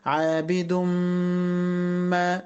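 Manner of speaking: عابد ما merged into a very long hummed m; long ghunna. Shown as a demonstration. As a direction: hold the m between عابد and ما. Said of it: "كلمة غنة ومد بمقدار حركتين". (غنّة مطوّلة في عابد ما)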